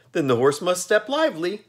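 very high, bright, cheery Jack voice; pleasant Pumpkinhead. A narrator's reading. Jack cheerfully reassuring the ferryman, very high and pleasant. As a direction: sing it out, untroubled and friendly. Jack's voice.

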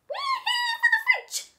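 very high squeaky mouse voice; stocky mouse at the door. A narrator's reading. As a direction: squeak it out cartoonishly. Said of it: "said a stocky mouse".

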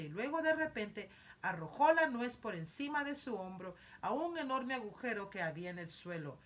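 ordinary delivery

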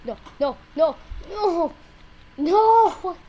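rising cries of protest, ending very loud; panicked shouting. Real, not acted. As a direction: build to a high yell as the game goes wrong. Panicking as the game goes wrong.

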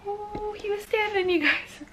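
surprised. Real, not acted. Sudden surprise at baby standing.

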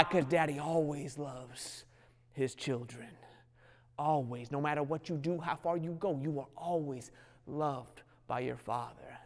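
hushed, slow promise of love; tender reassurance. Acted. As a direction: comfort them like a father would.

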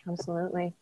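whispered softly. Real, not acted. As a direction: breathe the word. Whispered agreement.

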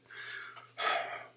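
imitated sigh of disgust. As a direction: blow out air in mock disgust. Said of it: "just disgusted, man".

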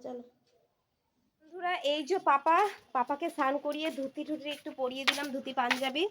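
normal talking voice

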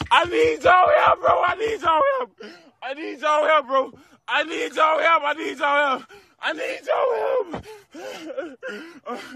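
high, panicked pleading scream; crying out for help in panic; desperate screaming. Real, not acted. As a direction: beg for help, voice cracking.

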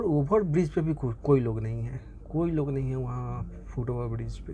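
ordinary delivery

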